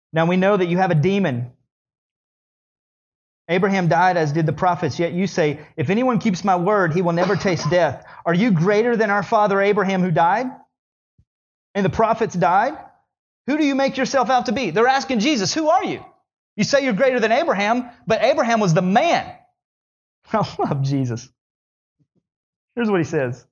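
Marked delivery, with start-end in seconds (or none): 0.1-15.5 heated sermon reading; preaching. Acted. Preacher voicing the Pharisees. 15.5-19.4 high, scoffing Pharisee voice; incredulous Pharisees; mocking challenge.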